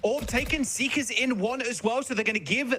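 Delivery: high, excited live call; esports caster. Real, not acted. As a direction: call the site take, pitch up. Live play-by-play of site take.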